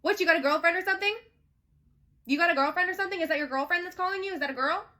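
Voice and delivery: high, loud interrogation, voice rising on each question; accusing questions. Retold or imitated. Woman grilling her man about a late caller, high accusing questions. Jealous confrontation of him, retold.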